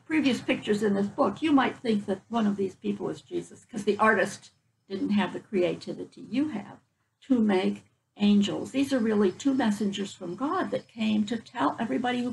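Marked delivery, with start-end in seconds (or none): none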